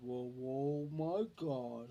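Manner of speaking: slow, drawn-out awestruck drawl; slow-talking Leroy impression. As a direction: imitate a slow talker, stretching the vowels. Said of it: "That's how he talked".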